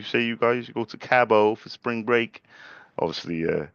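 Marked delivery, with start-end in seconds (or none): none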